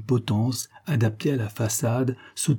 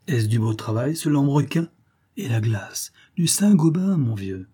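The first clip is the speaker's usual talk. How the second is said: hearty inventor voice, raised pitch, insisting; brisk physicist brushing it off; 4 semitones higher than usual. Acted. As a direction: cheerfully wave away the doctor's worry. Physicist voice, held.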